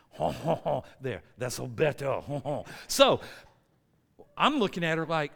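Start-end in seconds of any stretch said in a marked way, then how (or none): 0.1-2.6 mock French chortle; jokey second try at a French accent; exaggerated French accent. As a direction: lay a hammy French accent on thick. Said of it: "That's a horrible French accent".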